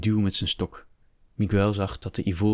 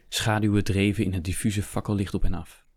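ordinary delivery

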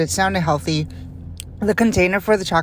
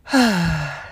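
long heavy sigh, falling away; big sigh. Real, not acted. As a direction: let out one big weary sigh. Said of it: "That was a big sigh for me".

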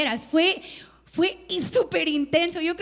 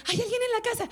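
put-on child asking; 8 semitones higher, faster than usual. Small son asks innocently.

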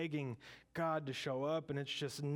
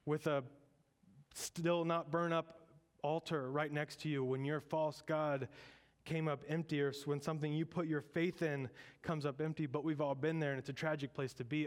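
ordinary delivery

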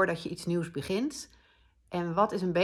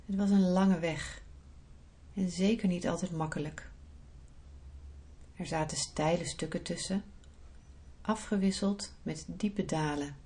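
soft, unhurried meditation voice; guided meditation; 8 dB quieter than usual. Acted. Leads a guided visualisation.

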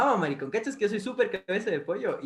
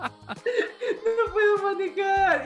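sister's wail, much higher, cracking with laughter; mock crying sister; 14 semitones higher, 6 dB louder, much slower than usual. Retelling his crying sister on the curb, mock wail through laughter. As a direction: laugh through a mock sob, high and slow. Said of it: "mi ñaña llorando diciendo".

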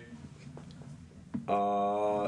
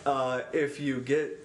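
ordinary delivery

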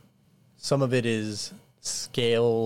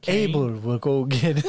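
peasant villager; 3 semitones higher, 6 dB louder than usual. Acted. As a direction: welcome the lords like a fawning villager. NPC repeats line.

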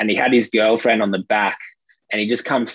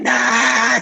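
high-pitched retold outburst; mock ballistic outburst; 12 semitones higher, 3 dB louder than usual. Angry Russian biker refusing help for his girlfriend, mock ballistic. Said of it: "just starts going off".

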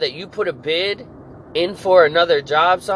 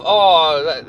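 ordinary talk

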